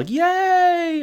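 high, drawn-out mock cheer; imitated yell. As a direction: yell a long, high-pitched yay in imitation. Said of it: "You just hear Corey yell".

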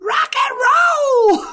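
loud, high, slow drawn-out rock shout; rocker shout. Acted. Cheering the shriek like a Kiss concert, loud high rock shout. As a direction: belt it like a rock star on stage.

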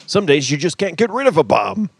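high, loud hammy hero line; movie quote voice. Retold or imitated. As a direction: ham up the campy Batman line. Quoting Batman line.

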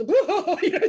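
sudden loud, high whoa of alarm; startled whoa. Retold or imitated. Mentor startled by a sobbing friend, loud and high. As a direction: blurt it out, shocked. Mentor reacting to crying caller.

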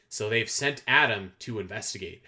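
ordinary delivery

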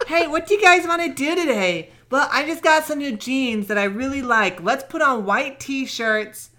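put-on high, valley-girl style woman's voice.

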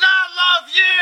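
loud, high, tearful declaration of love; overcome with emotion. Acted. Father makes up with his son after quarrelling, overcome with emotion. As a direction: wail it in hammed-up anguish. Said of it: "I get so emotional".

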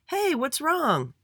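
high, drawn-out call; mild shout. A narrator's reading. Andy to Jace across the street, mild high shout. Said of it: "He shouted to him".

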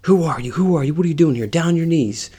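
loud, high, urgent police shouting; barking cop orders. Retold or imitated. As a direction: fire questions like a cop making an arrest. Acts out police confronting him.

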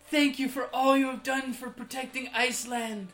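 fanboy awe. Acted. Fanboy addressing the great land vetter, gushing in awe. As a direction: gush like an awestruck fanboy. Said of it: "fanboy-ish, you know? And he's like".

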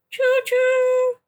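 mock train whistle. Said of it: "we are gonna do the whole episode like this".

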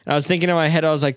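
ordinary talk

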